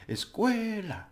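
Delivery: escuela with a high, stretched "cue" that falls; exaggerated stress. Shown as a demonstration. Teacher over-pronouncing to teach stress, drawn-out high middle syllable. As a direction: swoop up and linger on the middle of escuela. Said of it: "make it really exaggerated".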